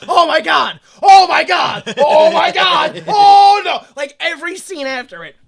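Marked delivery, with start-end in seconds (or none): 0.1-3.8 louder, rising mock panic, slowing; mock panicked oh my god. Characters reacting to every scene after the twist with rising mock panic. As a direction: spiral into mock horror. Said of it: "every scene is just".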